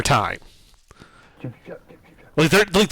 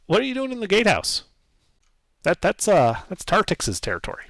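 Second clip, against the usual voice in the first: higher, lightly squeaky little gnome voice; squeaky old gnome; 4 semitones higher, 3 dB louder than usual. Acted. Old gnome driver greeting the stranger, lightly squeaky. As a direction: talk up like a small old gnome. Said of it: "speaks in a kind of soft, squeaky voice".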